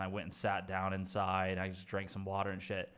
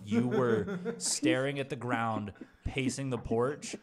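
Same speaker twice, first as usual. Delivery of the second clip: slow, loud, drawn-out retold accusation; deliberate, weighty imitation of the neighbors; emphatic correction; 4 semitones higher, 6 dB louder, slower than usual. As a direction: spell it out slowly and forcefully.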